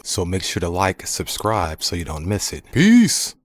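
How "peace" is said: high, loud goodbye; cheery sign-off. Real, not acted. Signing off to viewers, high and loud. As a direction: pop the farewell.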